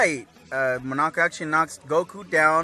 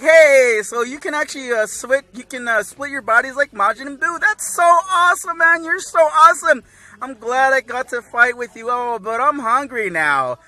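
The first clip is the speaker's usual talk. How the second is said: much higher and louder, gushing; excited Goku; 10 semitones higher, 7 dB louder than usual. Retold or imitated. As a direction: gush like a starstruck fan. Goku gushes about splitting bodies.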